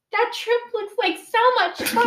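high-pitched mock old-person voice; elderly person impression. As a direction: gush like a sweet elderly churchgoer, hammed up. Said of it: "this elderly couple goes".

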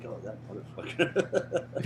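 soft, slow, muttered through laughter; laughing curse. Retold or imitated. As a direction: grumble it under a laugh. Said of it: "And I'm like".

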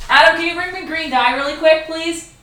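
calling out. Real, not acted. Calling to another room.

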